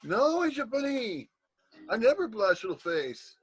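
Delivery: high cutesy denial, stretched at the start; playful bunny voice. Pretend. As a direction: coo a teasing denial up high. Playful pet-name denial of blushing.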